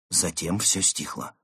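ordinary delivery

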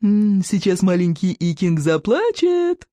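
put-on slow, drawn-out baby-talk taunt; snorting mockery. A narrator's reading. Bully teasing the pinned boy, snorting mockery. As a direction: stretch it out in mocking pity. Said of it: "фыркнул Сморкало".

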